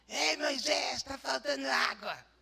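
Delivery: mock whiny grumbler; irritating whiny voice. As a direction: nag like a spoiled crowd. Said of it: "Eu tô falando com a voz irritante mesmo".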